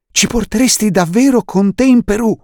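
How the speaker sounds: can't believe the good luck; thrilled kid asking; excited boy. Acted. Klaus asks, excited.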